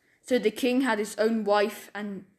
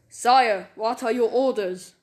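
calling guards; 5 dB louder than usual. A narrator's reading. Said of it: "they shouted".

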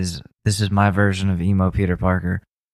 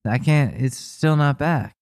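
ordinary talk, nothing marked

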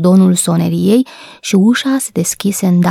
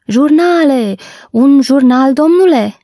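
newsboy's cry; 6 semitones higher than usual. A narrator's reading. Newsboy offers a gentleman his papers, calling out. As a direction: call out papers for sale, pitched up.

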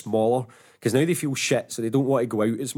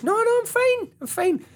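much higher, insisting he's fine; pitched-up protest that all is fine; high defensive denial. Retold or imitated. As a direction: squeak out a flustered denial.

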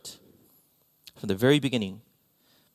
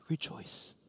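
whispered rejoice; 17 dB quieter than usual. Retold or imitated. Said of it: "he's like".

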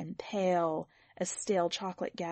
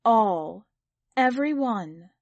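louder, proud insistence; mother proudly insisting, louder; more proudly; 8 dB louder, slower than usual. A narrator's reading. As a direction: press it out with pride. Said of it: "Anne said more proudly still".

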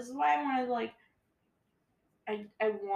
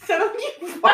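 laughing; 9 semitones higher, 10 dB louder than usual. Real, not acted. Teasing after noise, laughing.

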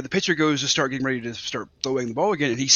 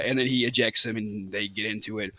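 ordinary talk, nothing marked

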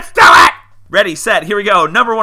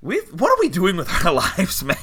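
loud, fast, laughing despair; laughing exasperation; 6 semitones higher, 6 dB louder, faster than usual. Real, not acted. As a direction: laugh it out in disbelief.

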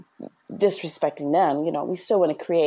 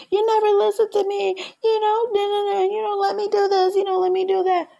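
put-on much higher, louder whiny child's complaint; whining kid. Parent mimicking a child's tantrum, high whiny complaint. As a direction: whine like a kid who's been told no. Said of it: "when your kids are loud and going off".

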